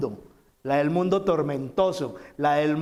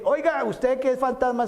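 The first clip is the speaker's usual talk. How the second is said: lightly voiced offhand remark, pitched up; mock dismissive address; 9 semitones higher than usual. Preacher mocking what Peter did not say to Jesus, offhand and high. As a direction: toss it off as if to a stranger. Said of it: "Y no le dice".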